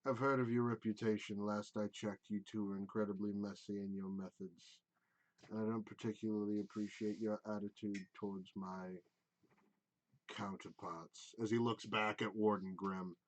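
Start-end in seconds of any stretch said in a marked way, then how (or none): 0.0-11.3 low, quiet, disdainful elf; cool contempt, held down and soft; a lightly lowered, icy mage voice; cold elf mage. Acted. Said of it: "he goes".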